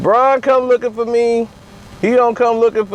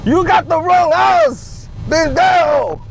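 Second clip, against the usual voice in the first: Mexican homeowner; 5 semitones higher, slower than usual. Acted. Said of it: "It's gonna be like".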